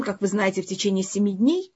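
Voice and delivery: ordinary talk, nothing marked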